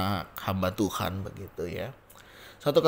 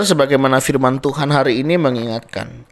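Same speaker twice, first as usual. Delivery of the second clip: ordinary delivery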